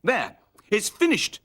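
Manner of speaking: high, pleased announcement; Sooty's proud voice. A narrator's reading. Sooty proudly tells Scampy the box is done. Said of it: "said Sooty".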